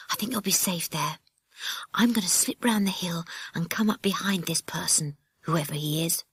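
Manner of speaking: hushed plan in a whisper. Acted. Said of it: "whispered Jack".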